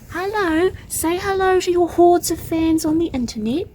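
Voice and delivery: high, cooing pet-talk. Real, not acted. Vlogger introduces her cat to online fans, high pet-talk.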